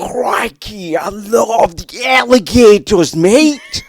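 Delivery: high, shouted bad Australian accent; hammed-up Australian yell, climbing in pitch; crocodile hunter impression. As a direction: go wildly over the top as an Aussie croc wrangler. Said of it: "the crocodile hunter".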